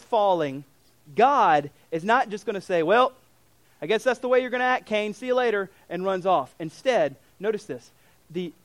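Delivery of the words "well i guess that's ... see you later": dismissive shrug. Acted. God imagined giving up on Cain.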